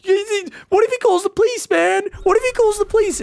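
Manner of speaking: high, loud screeching panic; screeching friend fleeing after throwing an egg; panicked mock shout. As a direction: yell at the driver to floor it. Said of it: "You're like".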